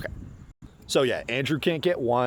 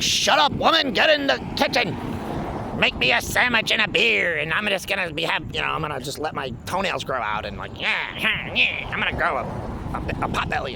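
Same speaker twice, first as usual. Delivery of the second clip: mock 1950s macho husband; 4 semitones higher than usual. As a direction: sneer out a boorish fifties husband barking orders. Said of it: "trying to bring back, like, nineteen forties and nineteen fifties".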